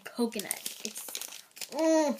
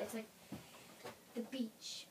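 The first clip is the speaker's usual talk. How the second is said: whisper; 3 semitones lower, 17 dB quieter than usual. Real, not acted.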